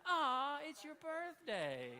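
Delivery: high, slow cooing aww; cooing nurse. Retold or imitated. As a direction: coo it like a sweet nurse.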